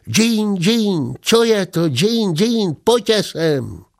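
high, sing-song fake Turkish; a high mock-foreign chant of nonsense syllables; mock foreign gibberish. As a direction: rattle off pretend Turkish in a high voice. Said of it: "Azt mondja".

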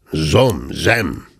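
low grumbling syllables; mocking low growl. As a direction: grumble it under your breath.

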